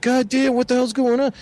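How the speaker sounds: high-pitched imitation of a startled friend; hammed-up squeaky alarm; mock alarm. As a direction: mimic his rattled outburst from the tent. Retells friend startled inside tent.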